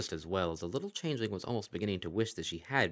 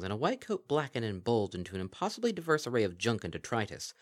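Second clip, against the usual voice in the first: ordinary delivery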